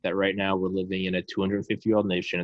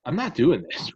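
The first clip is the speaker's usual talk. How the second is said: fed-up refusal; 8 semitones higher than usual. Retold or imitated. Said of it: "you gotta go".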